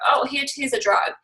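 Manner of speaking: mock prescription. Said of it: "We go".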